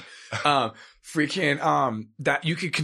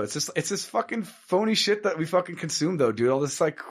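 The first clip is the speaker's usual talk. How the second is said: normal talking voice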